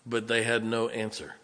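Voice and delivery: ordinary delivery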